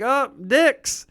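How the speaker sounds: teasing sing-song. Retold or imitated. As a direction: chime in like a cheeky sibling. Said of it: "Ash would be like".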